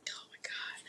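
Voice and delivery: very soft whispered aside; embarrassed whisper. Real, not acted. Cook embarrassed before her viewers, whispering. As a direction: whisper it to yourself, mortified.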